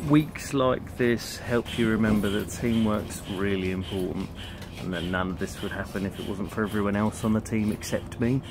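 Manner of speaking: ordinary talk, nothing marked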